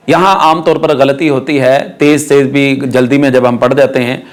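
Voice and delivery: ordinary delivery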